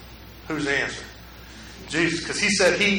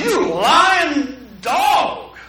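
slow drawn-out angry shout; scornful shout; 6 semitones higher, 4 dB louder, much slower than usual. Retold or imitated. The young man answers empty comfort with a slow angry shout. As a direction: spit it out in slow disgust. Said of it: "It's like".

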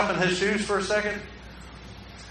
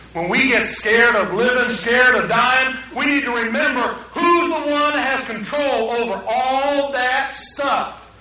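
ordinary delivery

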